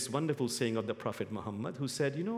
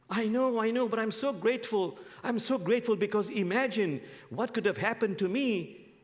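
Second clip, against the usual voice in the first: insistent grateful mullah; 8 semitones higher, 3 dB louder than usual. Acted.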